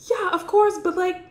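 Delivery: high, bright eager agreement; high-pitched gushing yes; eager Loretta. Retold or imitated. Loretta eagerly accepting the proposal, high voice. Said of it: "Loretta's like".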